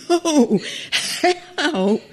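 high laughing call for help; half laugh, half plea; laughing through a retold cry for help. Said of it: "I said".

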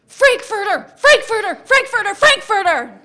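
high, loud repeated yell; yelling in their faces. Retold or imitated. Mom screaming at yogurt-covered kids, high loud yell. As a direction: bellow it at the kids in full rage. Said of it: "I just yell in their faces".

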